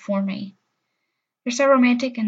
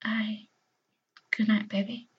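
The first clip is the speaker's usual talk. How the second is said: whispered goodnight; 6 dB quieter than usual. Acted.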